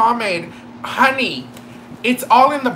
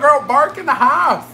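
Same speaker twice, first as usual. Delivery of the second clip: normal talking voice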